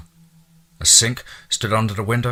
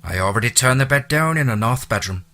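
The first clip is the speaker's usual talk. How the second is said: Irish brogue; 3 semitones higher than usual. Acted. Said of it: "her lyrical accent".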